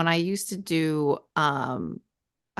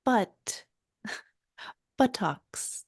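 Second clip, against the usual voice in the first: laughing meditation voice; 2 semitones higher, 7 dB quieter, much slower than usual. Retold or imitated.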